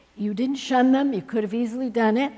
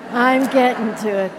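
louder, insistent second take; emphatic repeat; 2 semitones higher, 8 dB louder than usual. Real, not acted. Host repeating to the guest, louder and insistent. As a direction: lean into it, playfully firm. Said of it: "host repeats to guest".

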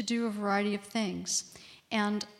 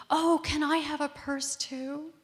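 put-on higher, drawn-out childlike plea; imitated little boy; 6 semitones higher than usual. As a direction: ask slowly and high, like a wishful small child. Little son's wish, retold.

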